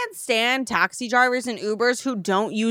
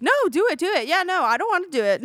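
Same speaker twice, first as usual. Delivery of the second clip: higher-pitched mocking back-and-forth; mocking imitation; 7 semitones higher than usual. Friend imitates the gaslighting driver, mocking. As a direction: mimic a pushy gaslighter in a raised pitch.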